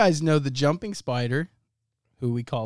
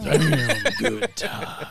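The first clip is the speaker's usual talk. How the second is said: laughing; 7 semitones higher, much slower than usual. Pretend. Laughing slow echo.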